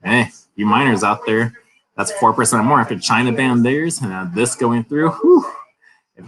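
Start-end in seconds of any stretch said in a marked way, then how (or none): 5.1-5.5 a high, loud whistle-like whew. Real, not acted. Vlogger marvels at miners' profits, high loud whew.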